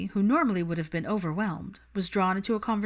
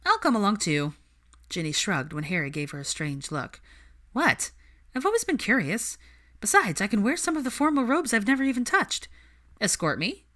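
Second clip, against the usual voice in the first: lively Ginny; 4 semitones higher than usual. Acted. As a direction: tease him into it brightly. Narrator voices Ginny.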